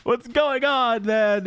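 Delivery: high, slow puzzled question; mock baffled player; confused player. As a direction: play a lost and confused bystander. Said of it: "People are like".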